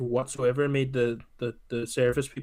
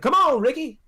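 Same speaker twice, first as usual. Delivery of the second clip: much higher, louder call; mock shout; 12 semitones higher, 6 dB louder than usual. Friend imitating Chris Tucker yelling for Ricky, loud shout. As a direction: yell his name across the room.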